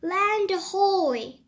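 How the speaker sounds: loud, slow shout of discovery; cried out. A narrator's reading. Noah spots land after days at sea, shouting it out. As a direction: call it out across the water. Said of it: "cried Noah".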